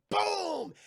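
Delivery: high, loud, drawn-out boom; theatrical explosion noise; gunshot boom. Acted. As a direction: shout the blast as a sound effect. Imitates gunshot.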